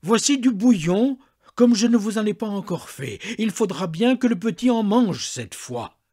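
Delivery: loud, high, commanding maid; a servant laying down the law; bossy servant's declaration. A narrator's reading. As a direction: announce it with authority, loudly. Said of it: "déclara".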